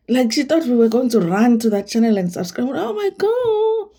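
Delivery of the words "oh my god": squeaked high, slow, disbelieving; high exasperated oh my God. Real, not acted. Vlogger to viewers about Minnie, high disbelieving oh my God. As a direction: let it climb way up in disbelief.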